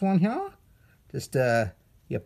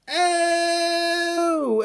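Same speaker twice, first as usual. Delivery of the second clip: disgusted oh; 13 semitones higher, 3 dB louder than usual. Real, not acted. Grossed out by slime.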